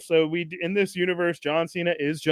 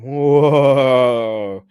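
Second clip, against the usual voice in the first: ordinary talk, nothing marked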